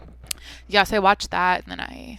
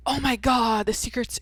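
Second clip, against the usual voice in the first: mocking media panic; 5 semitones higher than usual. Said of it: "the way the media makes it".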